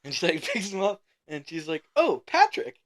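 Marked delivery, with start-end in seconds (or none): 2.0-2.8 much higher, louder mimicked woman's voice; delighted recognition. Lady greets the drunk friend she recognizes, high surprised greeting. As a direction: exclaim it high, imitating her surprise.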